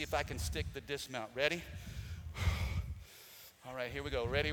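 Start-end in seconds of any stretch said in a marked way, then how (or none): 1.7-3.0 long, voiceless exhale; heavy sigh. Real, not acted. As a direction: blow out a bracing breath before a jump. Bracing before the dismount jump.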